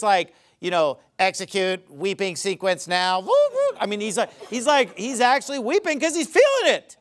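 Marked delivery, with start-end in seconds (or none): 1.2-3.8 mock robot voice with siren-like woos; robotic. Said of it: "is not just robotically".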